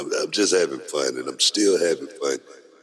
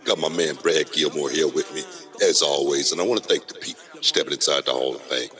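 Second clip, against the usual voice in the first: usual speaking voice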